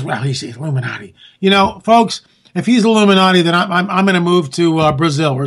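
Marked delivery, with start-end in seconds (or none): 0.0-1.1 mocking critic. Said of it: "please don't give me the emails".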